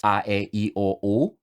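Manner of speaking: "A, E, I, O, U" as pure Spanish vowels, ah-eh-ee-oh-oo; careful Spanish sounds on "A, E, I, O, U". Shown as a demonstration. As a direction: say "A, E, I, O, U" with Spanish pronunciation. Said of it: "which are the sounds of the vowels".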